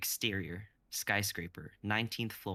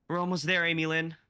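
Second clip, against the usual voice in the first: higher, louder encouraging call; 9 semitones higher, 7 dB louder than usual. Acted. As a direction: shout down to her through the gap. Kai calling down to trapped girl.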